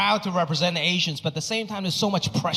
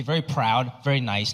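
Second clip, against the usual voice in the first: ordinary talk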